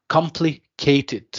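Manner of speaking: "complicated" slowly, COM hit firmly, in separate chunks; stress on first syllable. Shown as a demonstration. Teacher showing students the stressed syllable, slow stress on COM in "complicated". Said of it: "One of the syllables is pronounced strongly".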